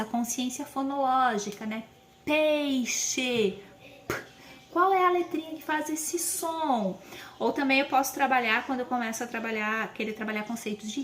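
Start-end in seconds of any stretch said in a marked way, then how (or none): 2.2-7.0 higher, bright questioning teacher tone; teacher voice to a child. Shown as a demonstration. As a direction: ask it like you're quizzing a small child. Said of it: "vamos trabalhar com que letra começa".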